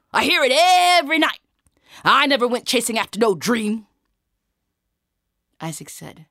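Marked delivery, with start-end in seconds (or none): 0.1-3.8 high, loud, exasperated guard; indignant bluster; scornful soldier. Acted. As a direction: declare it with stretched emphasis.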